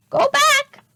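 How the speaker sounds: very high, loud parrot voice; squawking parrot. A narrator's reading. Polly the parrot warning the children, high squawk. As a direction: screech it like a parrot. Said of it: "squawked Polly".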